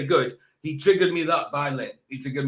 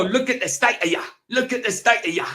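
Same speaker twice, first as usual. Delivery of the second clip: higher-pitched mimicry of an English accent, "state" said as "stite"; mock English accent; 6 semitones higher than usual. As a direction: badly imitate an English accent. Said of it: "sound like an English person".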